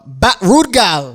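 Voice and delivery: slow mock-Jamaican slang; put-on Jamaican, drawn out; Jamaican accent. Shown as a demonstration. Host offers Jamaican phrases in a slow, put-on Jamaican accent. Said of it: "some Jamaican accents".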